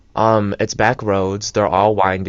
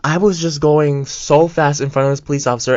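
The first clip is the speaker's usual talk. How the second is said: mildly panicked, higher-pitched realisation; retold freak-out; 6 semitones higher, 4 dB louder than usual. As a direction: open on a loud, high, dismayed "oh". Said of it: "I start freaking out".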